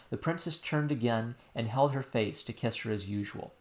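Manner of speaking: ordinary delivery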